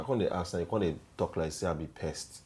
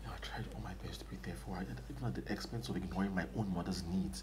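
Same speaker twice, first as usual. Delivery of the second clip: much softer, barely voiced regret; hushed confession; 9 dB quieter than usual. [name] tells his friend of his sacrifice, hushed. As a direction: confess it under your breath. Heartbroken man confides.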